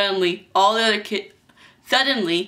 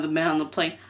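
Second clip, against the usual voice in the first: ordinary delivery, nothing marked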